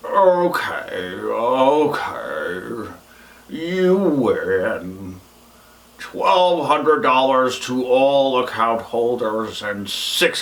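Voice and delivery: slow, low-pitched character voice conceding defeat; McDuckle. Acted. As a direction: give in slowly, drawing out each word. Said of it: "we've got McDuckle on the line".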